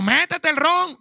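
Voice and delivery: loud, high mock invitation to drink; mock permission. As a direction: ham up a careless preacher egging them on. Mocking what he won't tell believers.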